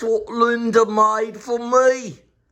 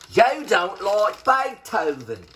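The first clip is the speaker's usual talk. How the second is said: ordinary talk, nothing marked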